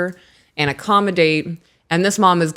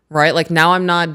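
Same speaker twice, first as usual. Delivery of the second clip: normal talking voice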